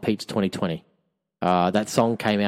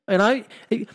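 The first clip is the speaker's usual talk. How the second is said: high-pitched rant; 8 semitones higher than usual. Acted. High-pitched mock comment rant.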